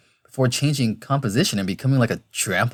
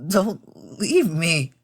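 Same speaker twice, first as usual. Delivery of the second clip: higher, slow, desperate begging; tearful plea; 6 semitones higher, much slower than usual. Acted. Crying rider to Tom, tearful plea. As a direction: beg slowly, on the edge of tears. Tears starts to form.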